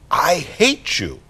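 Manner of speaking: drawn-out, hammed-up child's protest; tantrum. Four-year-old refused a treat lashes out at Mommy, mock tantrum. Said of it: "He throws a major temper tantrum".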